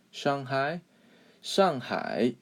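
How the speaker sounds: "Shanghai" with an ah vowel, rising at the end; careful model "Shanghai", falling then dipping-rising; correct Mandarin Shanghai. Shown as a demonstration. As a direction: say "Shanghai" as shahng-hai, Chinese tones. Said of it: "actually the correct way to say it".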